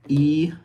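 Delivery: long E as in sheep, lips spread; vowel E. Shown as a demonstration. Teacher demonstrating the front vowel E clearly. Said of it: "We have".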